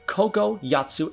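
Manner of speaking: usual speaking voice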